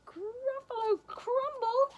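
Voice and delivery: very high, slow, loud questioning cry; horrified Gruffalo. Acted. Gruffalo hears he is food, high slow mock horror. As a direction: squeal it slowly in mock horror.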